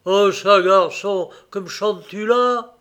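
loud, high-pitched disbelief; astonished. A narrator's reading. Father hears of a different bride, astonished. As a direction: blurt it out loudly, questioning your son. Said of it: "ouvrit de grands yeux étonnés".

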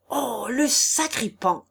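slow, high, drawn-out indignant cry; outraged howl. A narrator's reading. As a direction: stretch the insult into a mild howl. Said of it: "hurla le bailli". (cri outré, aigu et traînant)

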